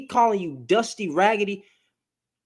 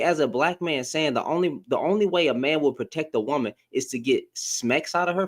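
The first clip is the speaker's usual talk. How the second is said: usual speaking voice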